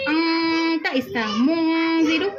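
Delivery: chanted "अंता ... मुंजिरु" with the n held nasal; nasal ghunna. Shown as a demonstration. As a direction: draw the n out through the nose. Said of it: "जब भी नून आएगा गुन्ना होगा".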